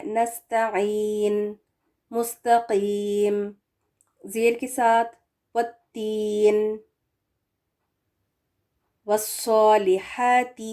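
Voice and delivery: slow, drawn Quranic recitation. Acted. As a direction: recite each word slowly and clearly.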